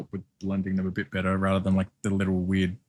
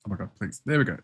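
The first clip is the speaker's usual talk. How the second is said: usual speaking voice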